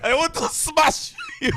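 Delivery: put-on high, weak French accent; bad French accent. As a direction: try a French accent and fail. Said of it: "I can't do a French accent".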